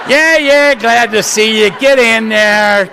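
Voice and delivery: hurried, pushy door greeter; impatient greeter. Retold or imitated. Greeter at the door hustling newcomers inside. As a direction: wave them in, laughing and impatient.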